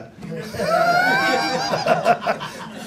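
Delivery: high, shouted burst of laughter; big laugh. Real, not acted. Laughs at own joke. (צחוק רם ופורץ)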